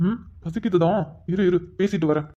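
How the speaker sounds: low, soft and irritated; hushed irritation. Acted. Husband hushes his wife so the caller won't hear, low and annoyed. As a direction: snap at her without letting the caller hear. Hushed quarrel beside phone call.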